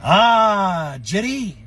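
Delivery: put-on French Canadian accent. Father vows to wait for Jerry, French Canadian accent. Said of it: "with his little French Canadian accent".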